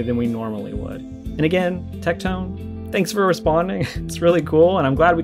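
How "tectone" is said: ordinary talk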